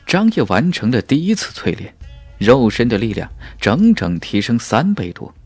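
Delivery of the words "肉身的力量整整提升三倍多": plain reading voice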